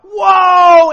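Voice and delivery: high, loud wowed shout; amazed shout. Retold or imitated. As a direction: shout in wonder at the dream. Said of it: "we're like".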